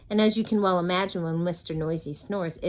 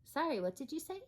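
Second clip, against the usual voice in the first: feigned not hearing; 8 semitones higher, 9 dB quieter than usual. Acted. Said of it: "pretending not to hear".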